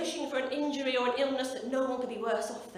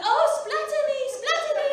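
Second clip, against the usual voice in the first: high, loud, exaggerated squeal; hammed-up ecstatic plea; mock porn actress; 13 semitones higher, 8 dB louder than usual.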